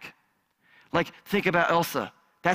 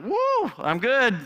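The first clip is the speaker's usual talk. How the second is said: mock cheer; 6 semitones higher, slower than usual. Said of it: "You're like".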